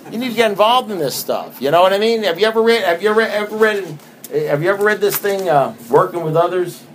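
preaching at full force; fervent urging. Real, not acted. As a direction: press them hard to get involved. Urging the listeners to sponsor.

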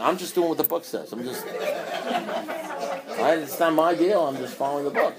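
words broken by laughter; real amused laughter; laughing. Real, not acted. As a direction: giggle through the disclaimer. Laughs, disclaims responsibility.

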